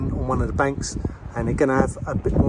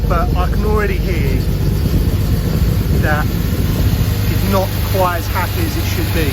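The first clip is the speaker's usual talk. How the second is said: raised voice; 4 semitones higher, 4 dB louder than usual. Real, not acted. Talking over running engine.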